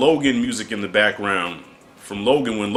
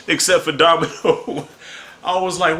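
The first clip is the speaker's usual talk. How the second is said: laughing while talking; 3 semitones higher than usual. Real, not acted. Laughing recalling the scene.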